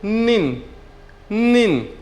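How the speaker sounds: निन said slowly and clearly as a model; pronunciation demo. Shown as a demonstration. Teacher modeling निन for students, careful pronunciation. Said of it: "कैसे pronounce करेंगे?".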